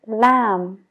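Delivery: "lamb" said as lam; silent b in lamb. Shown as a demonstration. Teacher repeats "lamb" for the class. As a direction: end "lamb" on the m. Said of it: "Ignora la B completamente".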